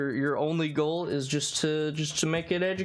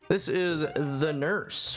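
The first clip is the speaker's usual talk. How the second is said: ordinary talk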